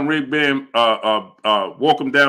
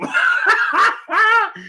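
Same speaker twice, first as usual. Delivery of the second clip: high laugh. Real, not acted. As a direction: burst of high-pitched laughter. Laughs at superchat.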